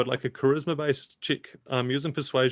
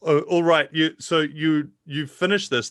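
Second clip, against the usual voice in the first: usual speaking voice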